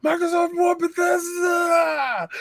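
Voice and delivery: high, slow, stretched mock yell; imitated excited shout. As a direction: drag out the big news like you can't believe it. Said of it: "He said it like that".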